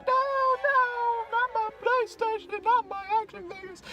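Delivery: very high, pleading whine; whiny kid. Retold or imitated. Boy losing his PlayStation, high squeaky pleading. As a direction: beg in a squeaky child's voice. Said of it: "And I'm like".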